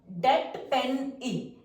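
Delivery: डेट को बहुत धीरे खींचकर; डेट का ए खोलकर धीमे सुनाओ; slow vowel demo. Shown as a demonstration. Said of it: "ये हमारी होंगे शॉर्ट वॉवल साउंड". (डेट धीरे-धीरे)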